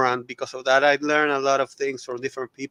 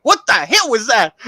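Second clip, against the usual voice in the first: higher and louder, startled; startled question; 7 semitones higher, 6 dB louder than usual. Retold or imitated. Friend's girlfriend startled by a weird sound, loud. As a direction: blurt it out, thrown by the noise. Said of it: "went like".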